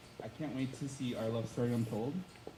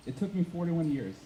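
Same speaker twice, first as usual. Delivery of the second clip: normal talking voice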